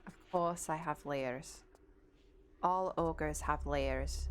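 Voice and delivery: low, slow Scottish ogre; Shrek voice. Acted. Streamer answering chat about layers as the ogre, low slow Shrek. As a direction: state it slowly and deeply like a Scottish ogre. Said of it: "when I pretended to be Shrek".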